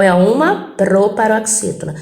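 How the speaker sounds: normal talking voice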